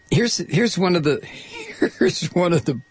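words broken by laughter; laughing. Real, not acted. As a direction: laugh through the line. Laughing while reading provision.

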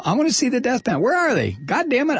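high-pitched mock tantrum; mock indignation. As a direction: demand it in playful outrage. Mock tantrum demanding death panels.

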